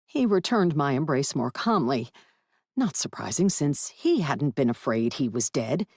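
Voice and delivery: ordinary talk, nothing marked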